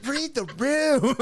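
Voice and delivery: high, drawn-out, laughing jibe; mock exasperation. Host echoing the film's punchline, mock exasperation.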